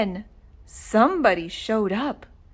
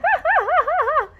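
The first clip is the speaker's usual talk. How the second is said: high, mocking howl of laughter; 4 dB louder than usual. Dogs laughing at Oscar's silly costume, howling laughter.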